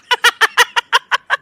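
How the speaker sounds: loud shrieking laugh; real laugh breaking out high; high burst of laughter. Real, not acted.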